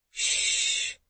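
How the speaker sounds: long, soft "shh"; soft calming hiss; soothing shush. A narrator's reading. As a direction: hush a frightened child gently. Susan hushes the screaming child.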